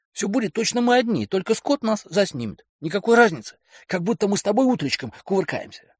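high, wheedling man; coaxing boyfriend. Acted. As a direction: talk her into it lightly. Kelly persuading.

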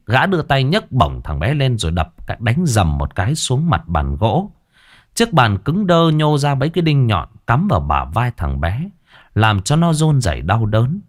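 ordinary delivery

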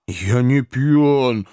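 very slow, drawn-out protest; wailing fat boy. A narrator's reading. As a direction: wail it out in denial. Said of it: "завопил жирный парень". (жирный парень вопит, растягивая)

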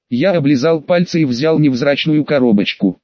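usual speaking voice

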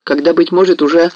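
alarmed cry, pitched high; cried out. A narrator's reading. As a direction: exclaim in sudden alarm. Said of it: "вскрикнул Мазепа".

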